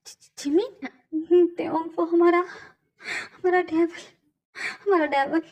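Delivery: slow, broken sobbing words; crying friend. Acted. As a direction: choke on the words through tears. Friend breaks the news crying.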